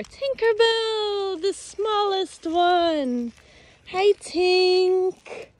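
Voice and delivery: high, slow, tender coo to a tiny goat; cooing pet talk. Real, not acted.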